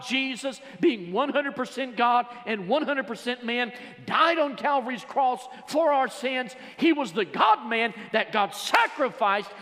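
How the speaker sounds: loud, high, slowed preacher's shout; preaching at full force. Acted. Preacher proclaiming Christ's sacrifice to the church, shouting it. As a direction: build to a shout on died.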